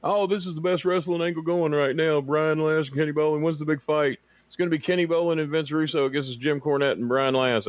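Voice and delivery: high put-on fan voice; fan impression. Kenny mocking fans asking about the big fight, high put-on voice. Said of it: "if he imitates somebody, he's doing their voice".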